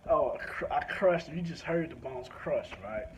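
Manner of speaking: usual speaking voice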